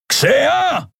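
high, far-carrying bellow; roar. A narrator's reading. Kero calls the pilot across the ship, roaring. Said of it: "brüllte er quer durch das Schiff".

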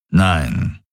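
low, rumbled reply; low grumble. A narrator's reading. Daraios contradicts Kirai, low grumble. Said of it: "brummte Daraios".

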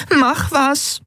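high, pressing voice for Nelen; urgent plea. Acted. Nelen urging the crew under attack, high and pressing. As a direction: push for action, pale and scared. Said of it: "drängte Nelen".

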